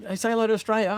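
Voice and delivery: hyped-up filmmaker. Retold or imitated. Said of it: "and then I'd be like".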